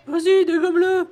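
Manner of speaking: high, eager cartoon cheer. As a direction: squeak out encouragement. Cheering on an attack.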